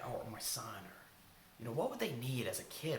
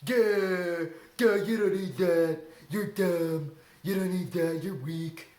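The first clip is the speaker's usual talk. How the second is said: louder, sneering mock critic; loud taunting inner critic; 4 semitones higher, 10 dB louder, slower than usual. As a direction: play the nasty voice in your head putting you down. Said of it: "That voice that says".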